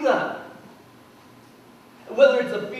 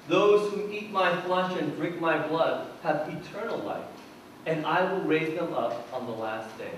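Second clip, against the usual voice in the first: scripture reading; 5 semitones lower, 6 dB quieter than usual. Acted. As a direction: read the Gospel solemnly to the congregation. Scripture reading before sermon.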